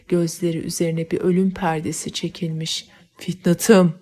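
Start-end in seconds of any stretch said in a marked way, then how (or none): 3.2-3.9 a lover's desperate shout over his dying beloved; anguished cry. A narrator's reading. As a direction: call her name out loud in despair. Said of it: "diye bağırır".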